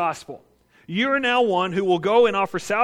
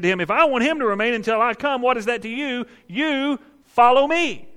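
stern rebuke; 7 semitones higher, 5 dB louder than usual. Acted. Jesus rebukes Peter, acted.

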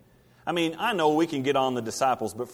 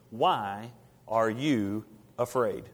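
slow, wondering question; 4 semitones lower, much slower than usual. Acted. Preacher musing on Jesus' question.

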